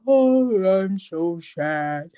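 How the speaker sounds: dopey, dragging sad-sack voice, sinking very slow; Eeyore. Retold or imitated. Mocking how gloomy the bride seemed, mopey donkey impression. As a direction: mope it out slowly, sad and dopey. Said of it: "Eeyore from Winnie the Pooh. She gave me sad. She gave me dopey".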